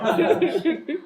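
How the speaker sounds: high-pitched real laugh. Real, not acted. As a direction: burst out laughing at the story. Laughs at the anecdote. (risa aguda)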